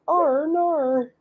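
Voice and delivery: high, drawn-out mock-Australian vowels; caricatured Australian accent. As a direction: stretch it into an exaggerated Aussie accent. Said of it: "all I can do for Australian".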